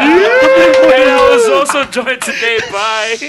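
high, loud, slow mock announcer; hammed-up show host. Redoes the intro in a put-on voice.

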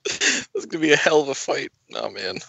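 hammed-up tearful line; mock crying. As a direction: sob through it jokingly. Continues fake crying.

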